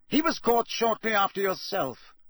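stern official. A narrator's reading. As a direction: interrogate crisply. Crouch to prisoner.

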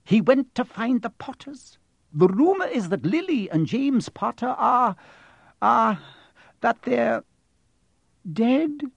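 high, urgent, halting report; anxious, high-pitched disclosure with hesitations; pressing on. A narrator's reading. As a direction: press on, faltering before the worst word. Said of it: "she pressed on".

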